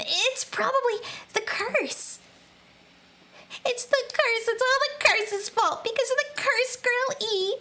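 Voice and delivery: high, frantic insistence on the curse; a hint of a scared girl fixated on the curse; panicked girl. Panicked character blaming curse.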